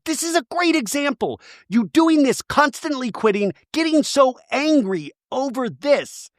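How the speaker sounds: high, insistent scolding imitation; mock exasperation. Recapper as housewife calling out friend's quitting, mock exasperated. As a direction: press each phrase in a put-on high voice.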